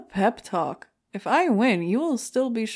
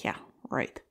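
whispered, lower, dismissive yeah right; sarcastic whisper; 9 semitones lower, 6 dB quieter than usual. Acted. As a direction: mutter it with sarcasm under your breath. Sarcastic reply to Granny's praise.

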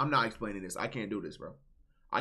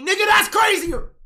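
high, loud shriek of disbelief; outraged shout; 15 dB louder than usual. Real, not acted. Streamer reacting to "you wanna be mommy", outraged shout. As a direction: scream it in outrage.

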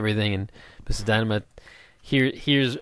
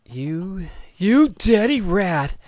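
slow, high, loud taunting voice; mock taunt; 8 semitones higher, 3 dB louder, much slower than usual. Player taunting a mob, slow high mock jeer. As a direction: drag it out like a playground insult.

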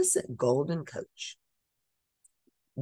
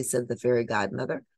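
ordinary delivery, nothing marked

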